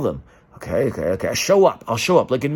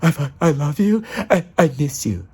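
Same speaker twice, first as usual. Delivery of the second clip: higher, put-on reading of a love letter; different tone read; 3 semitones higher than usual. Shown as a demonstration. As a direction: read "I, I love you and I miss you" in a lighter, higher voice. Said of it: "It could be read as".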